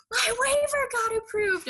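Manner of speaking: shaking him awake with the news; thrilled cry to a sleeping husband; excited announcement. Retold or imitated. Waking husband with big news.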